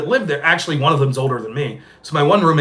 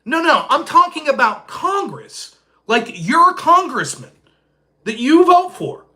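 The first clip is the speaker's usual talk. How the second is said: high, slow, incredulous explaining; slow, exasperated over-explaining; 10 semitones higher, 4 dB louder, slower than usual. Retold or imitated. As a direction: spell it out to someone dense. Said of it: "And I was like".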